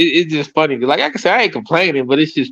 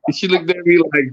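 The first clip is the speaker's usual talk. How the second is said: ordinary talk, nothing marked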